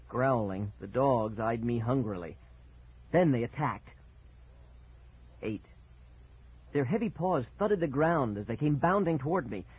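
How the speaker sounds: ordinary delivery, nothing marked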